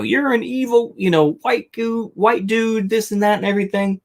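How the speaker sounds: high, whiny mocking protester voice; sneering imitation of a preachy activist. Mocking protesters shouting at diners.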